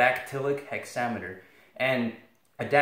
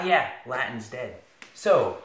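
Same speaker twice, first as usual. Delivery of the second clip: usual speaking voice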